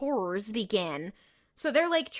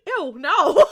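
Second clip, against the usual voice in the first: loud, higher-pitched mock revulsion; hammed-up disgust; mock disgusted denial; 6 semitones higher, 8 dB louder than usual. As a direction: play up an appalled denial. Acting out Dean's disgusted denial.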